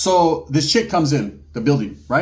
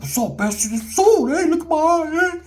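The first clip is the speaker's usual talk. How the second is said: challenging taunt; 13 semitones higher than usual. Retold or imitated. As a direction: pitch it way up and goad him. Bruised guy challenging, voice acted.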